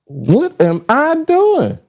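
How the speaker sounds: slow, high-pitched exasperation; exasperated at self. Real, not acted. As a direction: draw it out high, baffled at your own fumbling. Flustered by live tech trouble.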